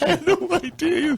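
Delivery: laughing hard, high and loud. Real, not acted. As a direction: speak through real laughter.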